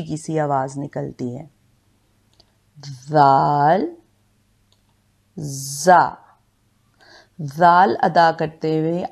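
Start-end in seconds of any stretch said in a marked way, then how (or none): 2.8-4.0 जाल with a soft, lisped dh, tongue between the teeth; soft dhal. Shown as a demonstration. Quran teacher showing a soft जाल, slowly. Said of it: "जाल को नरमी से अदा किया जाता है". 5.4-6.2 जा with a hard buzzing z, teeth closed; hissing za. Shown as a demonstration. Quran teacher contrasting a hissed जा. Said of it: "जबकि जा को जोर देकर पढ़ा जाता है".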